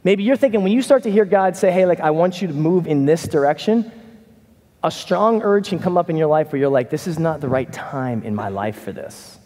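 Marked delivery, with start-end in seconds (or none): none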